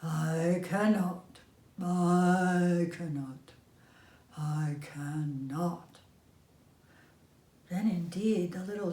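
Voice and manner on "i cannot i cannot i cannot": put-on old engine rumbling off to the roundhouse, fading; chugging away, fading. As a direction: drift off like a train leaving. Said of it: "off he rumbled to the roundhouse chugging".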